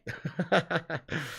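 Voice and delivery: short, soft chuckle; quiet laugh at a joke. Real, not acted.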